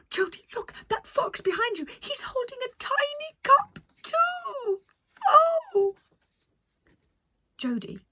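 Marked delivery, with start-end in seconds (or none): 0.0-6.0 very high, loud delighted squeals; Penelope squealing at a squirrel to her crew; thrilled. Acted. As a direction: gush over the little squirrel. Penelope sees fox with cup.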